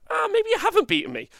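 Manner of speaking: very high-pitched mock retort; mock player banter. Imitating bickering players at the table, high mock-indignant squeal. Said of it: "that's how they talk".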